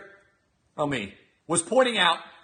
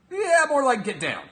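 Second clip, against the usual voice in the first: usual speaking voice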